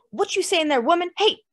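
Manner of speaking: high, loud challenge; angry shout. A narrator's reading. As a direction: shout her down. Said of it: "King shouted".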